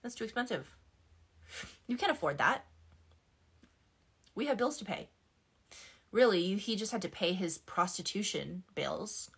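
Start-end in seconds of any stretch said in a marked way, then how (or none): 0.0-5.1 controlling ex voice. Retold or imitated. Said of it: "hear my ex's voice in my head all the time being like".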